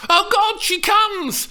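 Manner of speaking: put-on high, loud excited girl's yell; a girl yelling in excitement. A narrator's reading. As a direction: shout it as an excited young Juliet. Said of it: "Steph yelled".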